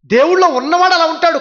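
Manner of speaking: high, loud restatement. Shown as a demonstration. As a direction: repeat it as a proclamation. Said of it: "అవునండి". (దేవుళ్ళో గట్టిగా, ఎత్తైన స్వరంతో)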